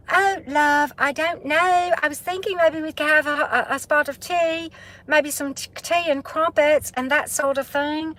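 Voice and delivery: high, sing-song British accent put on by a Southern speaker; a Southern woman's hammed-up British accent; Southern take on a British accent. Shown as a demonstration. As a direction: play a chatty Brit over tea, high and lilting. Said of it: "doing the Southern, Southern United States version of a British accent".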